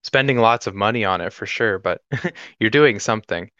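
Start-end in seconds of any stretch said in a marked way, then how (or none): none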